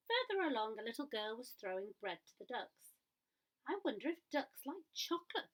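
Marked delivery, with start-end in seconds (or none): 3.7-5.5 wondering aloud. A narrator's reading. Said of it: "thought Gumboot".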